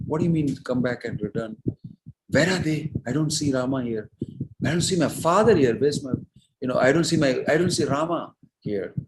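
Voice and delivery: acting out a bewildered son; agitated questioning. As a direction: press each question, pitch jumping up. Bharata acted questioning his mother, distraught.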